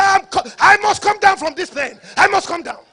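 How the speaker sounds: loud, high demand from a frightened passenger; imitated passenger yelling in panic; acted panicked shout. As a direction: shout to be let off, stumbling at the start. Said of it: "I was hearing the people shouting".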